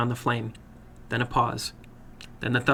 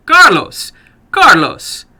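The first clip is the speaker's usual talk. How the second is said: frantic shout, much higher and louder; 10 semitones higher, 12 dB louder, much slower than usual. Acted. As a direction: yell his name in alarm.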